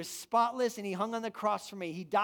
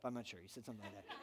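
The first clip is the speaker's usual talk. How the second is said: ordinary delivery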